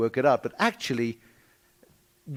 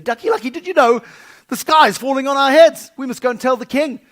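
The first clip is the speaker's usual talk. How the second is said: high, loud panicky little chicken; Chicken Licken; 12 semitones higher, 13 dB louder than usual. Acted. Chick telling Ducky Lucky the sky falls, high and panicked. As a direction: squawk the news in alarm.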